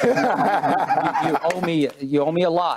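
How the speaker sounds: chuckling tease about an unpaid debt; laughing. Real, not acted. Teasing his co-host about their soda bets, laughing.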